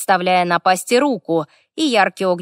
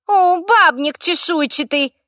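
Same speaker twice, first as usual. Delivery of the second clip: high, slow creaky taunt; jealous fire elemental; 10 semitones higher, 3 dB louder, slower than usual. A narrator's reading. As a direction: jeer in a high, grating little voice. Jealous elemental scolds the dragon. (ехидный скрипучий голос элементали)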